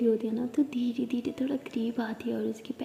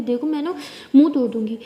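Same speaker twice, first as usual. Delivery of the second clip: louder, higher indignant rant; mock angry outburst; 4 semitones higher, 7 dB louder than usual. Wife pretends to be offended at being called argumentative, loud mock anger. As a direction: fire back in playful fury.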